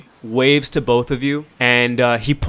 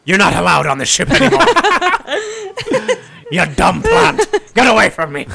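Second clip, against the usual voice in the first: loud, high mock outrage; captain yells at the plant, laughing; mock angry yell; 11 semitones higher, 6 dB louder than usual. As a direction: shout her off the ship, laughing.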